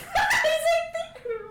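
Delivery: high, loud laugh through the words; shrieking laughter. Real, not acted. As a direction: laugh so hard the words squeak. Player bursts out laughing.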